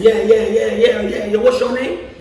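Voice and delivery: high, loud string of impatient agreement; hammed-up brisk interrogation; Denzel Washington. Denzel Washington cutting off a flustered young actor, mock impatient. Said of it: "He said".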